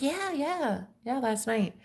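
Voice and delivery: soft, evasive imitation; mimicked shifty, hushed confirmation; iffy. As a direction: agree quietly without conviction, starting high. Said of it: "Like, like, iffy".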